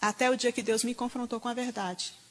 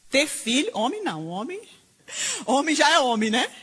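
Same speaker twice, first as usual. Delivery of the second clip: loud, high sarcastic jab; mock the double standard; 4 semitones higher, 5 dB louder than usual. Preacher mocking a pastor's claim about women, loud sarcasm.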